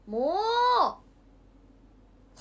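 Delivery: 大きく「もう」と鳴く; 牛みたいに強く鳴く; キリンの鳴き真似. Pretend. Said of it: "キリンってもうっていうの？". (大声で「もう」と鳴き真似)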